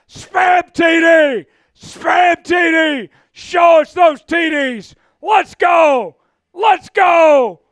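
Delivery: roaring play-by-play shout; touchdown call. Real, not acted. Streamer screaming a Seahawks touchdown to chat. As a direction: yell the touchdown like a stadium announcer.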